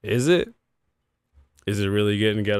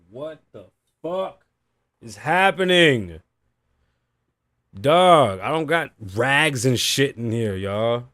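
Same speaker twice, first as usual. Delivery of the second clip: slow, raised-pitch disbelief bursting louder; real, building exasperation; exasperated; 3 semitones higher, 4 dB louder, slower than usual. Real, not acted. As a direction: drag out the first words, then burst out in frustration. Overheated host losing patience with show.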